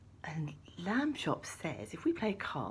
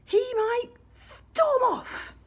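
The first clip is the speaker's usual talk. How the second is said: mock alarm; 7 dB louder, much slower than usual. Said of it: "if we don't play Monopoly".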